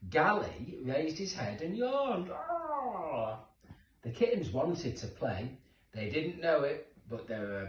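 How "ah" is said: a high little yawning ah; kitten yawn. Acted. Reading to children, a high kitten yawn.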